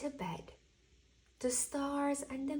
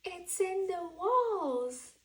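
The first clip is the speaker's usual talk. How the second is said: a mild mock screech, higher, louder and slow; Owl screeching; 8 semitones higher, 5 dB louder than usual. Owl hears the walls, a mild screech. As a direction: drag it out like a frantic owl. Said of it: "Owl screeched".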